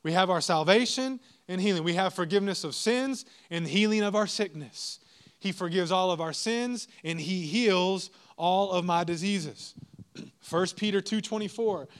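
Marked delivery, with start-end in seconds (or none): none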